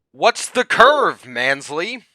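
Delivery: high, loud, slow taunt; loud mocking challenge. Real, not acted. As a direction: call them out loudly. Mocking challenge to flat earther.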